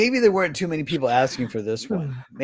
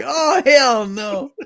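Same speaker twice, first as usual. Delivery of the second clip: high, slow, laughing "Oh, hell no"; yokel exclaiming; 12 semitones higher, 6 dB louder, much slower than usual. Acted. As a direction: drag it out in mock Southern disbelief. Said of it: "and he's like".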